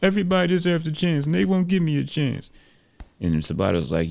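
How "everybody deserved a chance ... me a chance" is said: high, pleading boxer's complaint; frustrated. Acted. Ruben complains nobody will give him a chance, high frustrated voice. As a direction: plead it high, with aggrieved insistence. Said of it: "frustrated, shaking his head".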